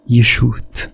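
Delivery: ordinary talk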